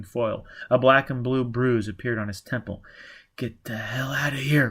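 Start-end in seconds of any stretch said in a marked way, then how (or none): none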